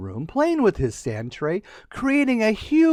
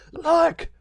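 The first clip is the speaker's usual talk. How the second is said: excited look; 10 semitones higher than usual. Acted. Princess excited again.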